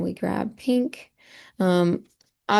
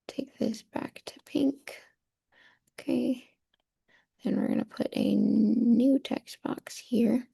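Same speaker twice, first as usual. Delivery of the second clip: whisper; 4 semitones higher, 5 dB quieter than usual. Real, not acted. Teacher mutters to herself while editing.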